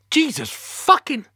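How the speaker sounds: loud, high, drawn-out swearing; exasperated outburst. Acted. Captain swearing at the call handler, exasperated and loud. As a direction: explode in frustration, slowly.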